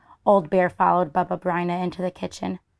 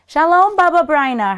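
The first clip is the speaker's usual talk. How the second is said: high, loud festive hello; cheerful greeting; 8 semitones higher, 9 dB louder than usual. Acted. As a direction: call out a greeting at the door. Neighbours calling at the door.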